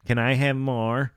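put-on creepy child voice. Creepy ghost child asks again.

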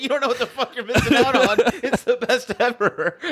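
high-pitched, laughing through the words; laughing protest. Retold or imitated. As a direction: argue the point while cracking up. Mock argument over food, laughing.

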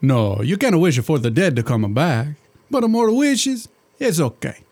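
put-on thick comic Italian genie accent; a jolly Italian genie, hammed-up accent. Genie chatting to his master in a hammed-up Italian accent.

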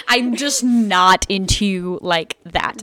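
loud, slow "I'm just not into you like that"; exasperated emphasis. Retold or imitated. Girl refusing a boy's endless invitations, exasperated emphasis. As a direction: spell out "not into you like that" word by word, loudly. Exasperated replay of repeated rejection.

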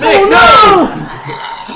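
high, loud drawn-out wail; hammed-up shout of horror; dramatic cry. Crew seeing the mechanic collapse, mock anguished shout. Mechanic suddenly has a heart attack.

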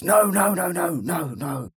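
high protest fading to a mutter; denial. Retold or imitated. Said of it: "I used to stress".

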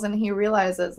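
ordinary talk, nothing marked

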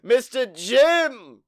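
loud, drawn-out ringmaster intro; show announcer. Acted. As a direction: stretch the key words, building to the name. Podcast host's show introduction.

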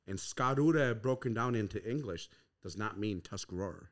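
ordinary talk

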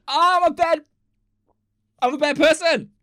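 loud, high, over-the-top self-reproach; guilty wail. Real, not acted. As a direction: wail in comic remorse at yourself. Guilty after joking about sad post.

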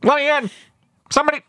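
high, strained mock distress call; hammed-up wounded pilot in an odd accent; Bulldog. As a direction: plead over the radio in a silly high voice. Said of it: "picturing that scene with that voice".

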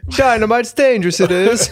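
put-on high, fretful child voice; little boy voice. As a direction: warn like a nervous little kid.

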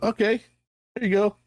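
high, innocent offering voice; feigned innocence, handing over the cup; high mock innocent. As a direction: sweetly hand it over as if harmless. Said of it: "And I'm like".

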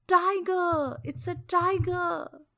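loud, high panicked cry; terrified shout. Acted. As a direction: scream the warning in terror. Prince sees tiger.

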